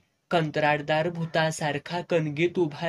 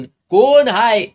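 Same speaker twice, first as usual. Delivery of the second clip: slow, much louder, higher shout into the dark; husband roaring at the man running away; furious shout; 6 semitones higher, 12 dB louder, much slower than usual. A narrator's reading. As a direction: bellow it at the intruder.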